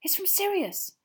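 high, bright cry of discovery; excited shout. A narrator's reading. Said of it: "He ripped open the letter and shouted".

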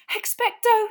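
usual speaking voice

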